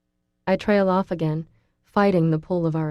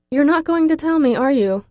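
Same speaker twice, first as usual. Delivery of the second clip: higher, louder exasperated question; playful frustration pushing up; a touch of exasperation; frustrated; 9 semitones higher, 6 dB louder than usual. A narrator's reading. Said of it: "feeling frustration".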